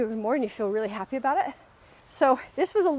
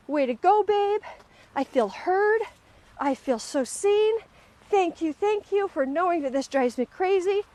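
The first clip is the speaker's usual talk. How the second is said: higher, bright mock-grateful voice voicing the praise she wished for; hoped-for praise; 7 semitones higher than usual. Imagining her husband's praise, she plays it mock-gushing. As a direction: lightly play the thankful, beaming praise she imagined. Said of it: "And I was hoping for acknowledgement".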